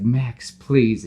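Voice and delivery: mock pleading call. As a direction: call after him, begging. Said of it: "And she's like".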